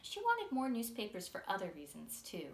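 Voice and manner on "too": ordinary delivery, nothing marked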